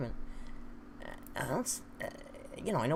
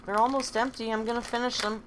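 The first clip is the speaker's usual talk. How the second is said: ordinary delivery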